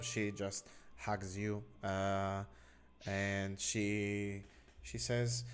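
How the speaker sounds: normal talking voice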